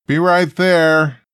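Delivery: loud call up the stairs. Acted. Uncle calling down to the father, loud. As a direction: shout it to someone on another floor.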